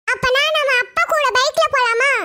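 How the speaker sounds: put-on slow, drawn-out childish question; sing-song kid making a suggestion; wheedling child. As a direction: coax mother in a little-kid voice. Child suggests riding with dad.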